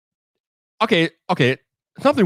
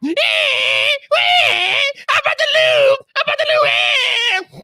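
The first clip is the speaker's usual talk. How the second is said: very high, wailing mock crybaby; Donald Trump; slower than usual. Streamer mocks a complainer about to lose, wailing in imitation. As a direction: whine and wail like a sore loser. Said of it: "You are acting like Donald Trump right now".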